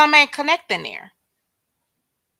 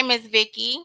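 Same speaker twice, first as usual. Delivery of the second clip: ordinary talk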